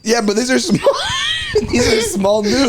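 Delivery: high protest broken by real laughter; laughing. Real, not acted. Host defending the small news items to friends, laughing. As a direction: defend yourself while cracking up.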